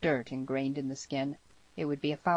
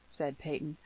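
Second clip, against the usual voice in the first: ordinary delivery, nothing marked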